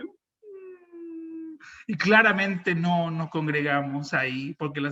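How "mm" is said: tiny high, long whine; very soft, high hum of dismay; mock whimper. Tenant is left speechless by God's taunt, mock whimper. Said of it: "Yo así como que".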